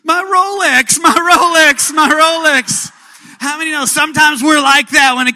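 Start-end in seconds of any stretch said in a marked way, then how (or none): 0.0-2.9 high, loud anguished wail; wailing over his Rolex. Retold or imitated. A man who lost his arm wails about his Rolex, loud and high. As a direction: cry out in comic despair for the watch. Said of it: "And he goes".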